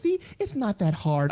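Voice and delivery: high, slow teasing impression of a wife; imitated wife. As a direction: coax like a wife, pitched high and drawn out. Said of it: "she would do stuff where she would".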